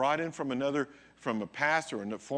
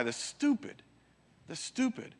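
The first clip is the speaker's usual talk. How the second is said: scoffing; 10 semitones higher than usual. Retold or imitated. Old guys mocking young man.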